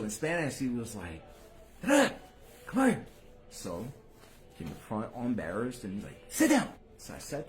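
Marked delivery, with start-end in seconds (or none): 1.8-3.0 a sharp, higher call from a stern father. Retold or imitated. Pastor father calling his noisy son in church, stern and sharp. 6.3-6.7 snapped high, loud command; stern order. Retold or imitated. Father ordering his embarrassed son to sit, sharp and loud.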